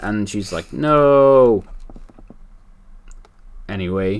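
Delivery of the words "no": firm loud refusal. Retold or imitated. Heroine refuses in retold comic.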